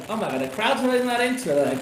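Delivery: high, loud shocked exclaim. Retold or imitated. Recalling his reaction to the flat crowd, shocked outburst. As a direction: blurt it out in shock. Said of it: "And I was like".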